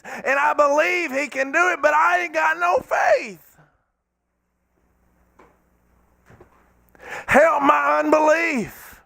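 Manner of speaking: building from a plea to a high shouted cry; desperate father's rising plea; pleading cry. Acted.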